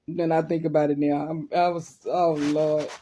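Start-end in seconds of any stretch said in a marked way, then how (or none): none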